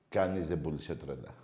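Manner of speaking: ordinary talk, nothing marked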